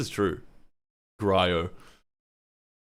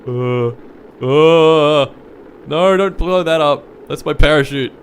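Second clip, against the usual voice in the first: alarmed; 5 semitones higher, 8 dB louder, slower than usual. Real, not acted. Panicking as the parachute is threatened.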